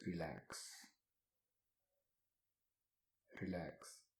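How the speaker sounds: soothing relaxation cue. Real, not acted. Guide telling listeners to let the whole body relax, soothing. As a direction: let each word sink and fade.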